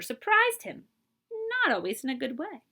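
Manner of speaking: ordinary delivery, nothing marked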